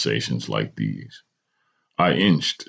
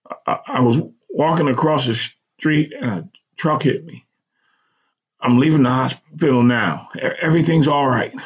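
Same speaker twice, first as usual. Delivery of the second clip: higher, faltering account; shaken, stammering; 12 semitones higher than usual. A narrator's reading. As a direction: explain it while barely holding on. Son explains accident to mother.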